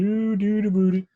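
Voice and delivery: hammed-up Canadian "aboot"; mock Canadian accent. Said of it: "That's what a Canadian says".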